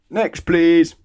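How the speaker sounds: high, loud brusque call; loud dismissal. Acted. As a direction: wave the next customer on loudly. Greengrocer dismissing.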